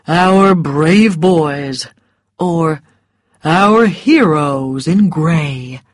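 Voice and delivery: slow, mocking and contemptuous; sarcastic. A narrator's reading. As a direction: drawl it out as an insult. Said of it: "made it sound like an insult".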